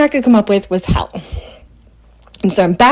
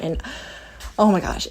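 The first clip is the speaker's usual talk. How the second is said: normal talking voice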